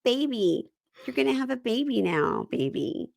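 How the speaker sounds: high, cooing start dropping at the end; imitating the mother-to-be. Host mimics her telling him about the baby, cooing. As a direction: coo it high, then let it fall. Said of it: "And she goes".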